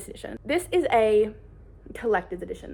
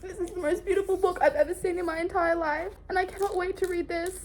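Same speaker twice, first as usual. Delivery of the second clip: much higher, starry-eyed gushing; awestruck gush; 9 semitones higher than usual. Real, not acted. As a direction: swoon over the most beautiful book. Gushing over the book.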